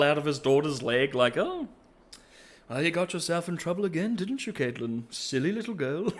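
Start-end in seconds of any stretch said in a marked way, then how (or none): 1.4-6.1 high, breezy, fast chirpy dad voice; happy oblivious dad. Retold or imitated. Father teasing his injured daughter, high chirpy voice. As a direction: toss it off like a cheerful clueless father.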